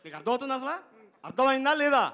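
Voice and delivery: high, loud, fast scolding; impatient director impression. Director scolding the assistant over five minutes, loud and fast. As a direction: tell him off fast and sharp. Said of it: "director గారు".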